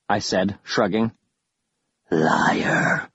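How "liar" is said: low, breathy hissing whisper; hissed whisper. Acted. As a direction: hiss it like a ghost. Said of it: "one hissed".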